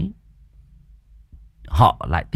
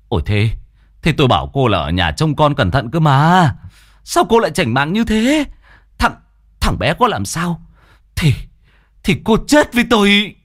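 a stammering, rising rebuke, higher at the peak; angry scolding; 7 semitones higher, 4 dB louder than usual. Acted. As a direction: scold her, furious and scared. Husband scolds wife, son missing.

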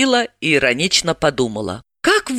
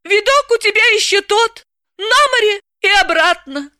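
much higher, gleeful gloat; gloating Galka; 3 dB louder than usual. Acted. Galka mocking Dalila's battered face, high gloating voice. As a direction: tease her friend with relish. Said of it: "злорадно отметила".

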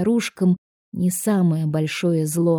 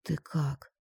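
much softer, lower murmur; mumbling; 4 semitones lower, 12 dB quieter than usual. A narrator's reading. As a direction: barely voice the question. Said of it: "забормотал он".

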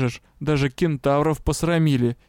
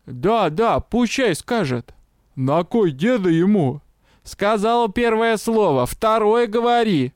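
crowd heckler; 6 semitones higher, slower than usual. A narrator's reading.

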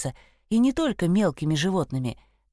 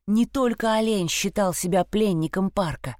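ordinary delivery, nothing marked

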